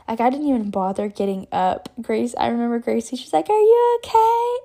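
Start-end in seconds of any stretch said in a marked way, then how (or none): 3.5-4.6 high, loud imitation of a concerned friend; worried friend calling. Friend calling to the injured skier, imitated concern.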